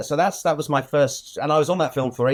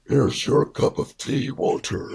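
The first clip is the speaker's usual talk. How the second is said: Walter Murch impression; slower than usual. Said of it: "in your imitation of him, by the way, is brilliant".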